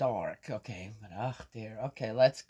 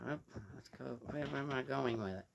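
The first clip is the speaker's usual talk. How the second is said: normal talking voice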